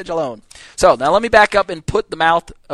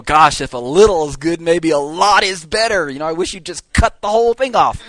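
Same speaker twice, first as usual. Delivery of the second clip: sarcastic jab; 2 semitones higher, 4 dB louder than usual. Retold or imitated. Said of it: "he tells them".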